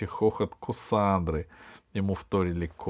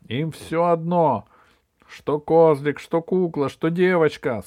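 raised, loud scolding list; indignant grandmother; 6 semitones higher, 6 dB louder than usual. Acted. Grandmother complains to Красная Шапочка, loud indignant protest. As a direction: hit each item indignantly.

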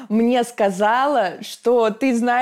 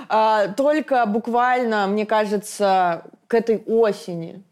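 ordinary talk

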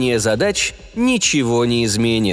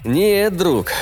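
objecting; 7 semitones higher than usual. A narrator's reading. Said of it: "возразил я".

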